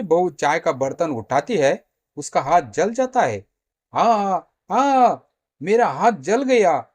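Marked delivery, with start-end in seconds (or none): 3.9-6.9 high, loud acted yelps of pain; crying out in pain. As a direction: wail over a burnt hand. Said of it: "वो जोर जोर से चिल्लाकर".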